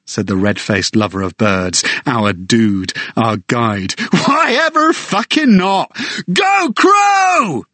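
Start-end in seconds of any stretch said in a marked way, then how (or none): 4.1-7.6 much higher shout; wild cheer. Acted. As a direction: let it rip for the crow. Guide cheering wildly.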